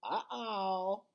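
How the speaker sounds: high squawky parrot uh-oh; lightly voiced parrot chirp. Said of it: "says Thelma".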